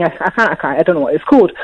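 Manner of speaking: ordinary talk, nothing marked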